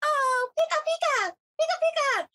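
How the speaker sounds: high, squeaky Pika, Pika cries in a cute creature voice; Pikachu impression. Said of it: "Pikachu was like".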